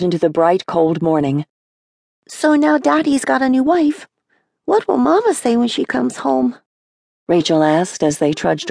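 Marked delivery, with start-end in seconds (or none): 2.3-6.6 put-on high, small child's voice asking; a five-year-old puzzled about Mama; voicing a little girl, higher. Little sister Rachel asks.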